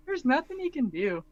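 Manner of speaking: normal talking voice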